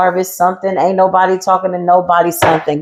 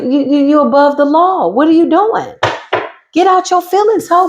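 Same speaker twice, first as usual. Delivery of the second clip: much higher, teasing sing-song; mocking taunt; 7 semitones higher than usual. Taunting the hidden enemy, much higher and teasing. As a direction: taunt them with fake concern.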